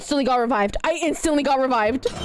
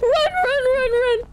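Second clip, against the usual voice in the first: frantic shouting; slower than usual. Real, not acted. Screaming at monster to run.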